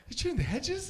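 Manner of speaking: very high, loud, astonished echo; Mark Wahlberg. Acted. Movie star asking his gardener again, high-pitched incredulous impression. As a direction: squeak up in overblown disbelief. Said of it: "Does he talk like that every day?".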